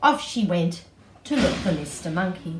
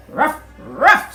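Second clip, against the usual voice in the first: loud, high barking ruff; dog bark; 8 semitones higher, 12 dB louder than usual. Acted. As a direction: bark like a puppy. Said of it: "said Mister Dog".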